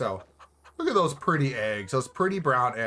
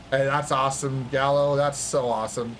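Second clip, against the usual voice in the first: usual speaking voice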